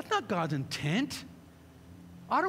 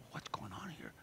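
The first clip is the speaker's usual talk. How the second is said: lower, much softer aside; hushed puzzled disciples; 11 semitones lower, 15 dB quieter than usual. Retold or imitated. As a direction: disciples muttering in confusion.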